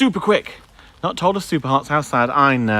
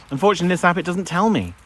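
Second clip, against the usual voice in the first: normal talking voice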